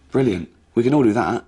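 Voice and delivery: normal talking voice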